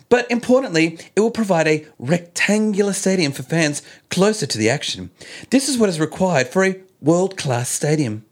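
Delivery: lightly voiced politician quote; Gladys impression. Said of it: "my best impersonation of Gladys".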